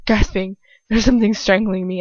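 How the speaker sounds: usual speaking voice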